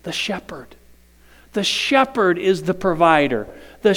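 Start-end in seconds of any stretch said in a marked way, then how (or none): 0.0-0.8 unvoiced answer to the question; hushed reveal; whispered answer. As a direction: breathe the answer. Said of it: "rested in who?".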